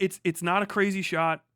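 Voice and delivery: ordinary talk, nothing marked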